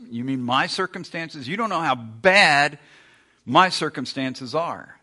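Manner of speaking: mock outraged objection; indignant protest. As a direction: push back, offended. Objecting listener voiced by preacher.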